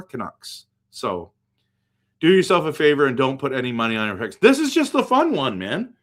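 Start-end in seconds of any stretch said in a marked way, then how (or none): 4.4-5.9 much higher, louder protest; excited insistence. Real, not acted. Telling viewers not to bet, excitedly insisting it's for fun.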